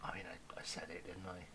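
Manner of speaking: hushed whisper, barely voiced. Real, not acted. Fearing he jinxed his team, whispered. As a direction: whisper it like you've jinxed the game. Jinxing himself after predicting comfort.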